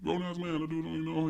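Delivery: high-pitched imitation of dad; dad's carefree retort. Dad shrugging off his child's worry, carefree. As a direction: brush it off in a high voice. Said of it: "He's like".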